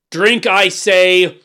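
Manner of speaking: slow, high, loud command; shouted order. A narrator's reading. Monstrous king ordering the dwarf to drink, shouting. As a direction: bellow each word as a threat. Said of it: "shouted the monster".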